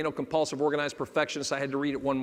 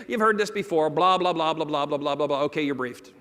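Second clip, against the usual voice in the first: mock droning run-through, sinking in pitch; bored rote briefing; 3 dB louder than usual. Pilots brief flight attendants who ignore them, mock bored drone. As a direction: rattle it off by rote, trailing down.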